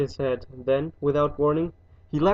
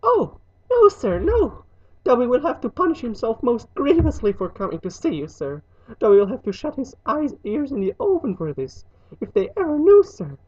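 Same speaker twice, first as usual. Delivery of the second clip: high, alarmed elf voice; loud cry of no, then high explanation; shuddering house elf; 9 semitones higher, 5 dB louder than usual. A narrator's reading. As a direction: squeak in dread of punishment. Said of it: "Dobby shuddered".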